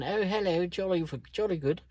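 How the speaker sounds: high-pitched imitation of a bad British accent; mock British accent. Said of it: "He's trying to do his, uh, British accent".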